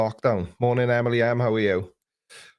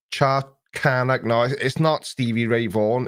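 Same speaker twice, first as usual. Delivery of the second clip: ordinary talk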